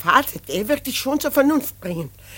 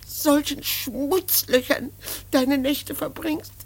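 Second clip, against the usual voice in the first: tearful; 4 semitones higher than usual. Acted. Mother breaks down over son.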